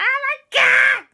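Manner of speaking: very high, loud shriek; overexcited shriek. Acted. Auntie ignores calls to calm down, shrieking. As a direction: scream it, drawn out, with overblown joy.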